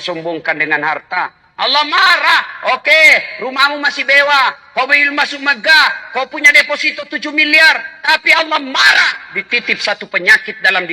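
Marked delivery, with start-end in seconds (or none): 1.5-9.3 loud, high preaching of God's wrath; booming. Acted. Preacher warning the rich, booming loud. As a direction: thunder it at the congregation.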